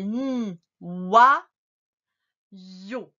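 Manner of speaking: a long NI, loud WA, then YO; sounding out. Shown as a demonstration. Teacher helps with a hard word, sounds stretched apart. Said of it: "Ça fait".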